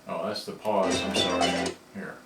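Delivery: ordinary talk, nothing marked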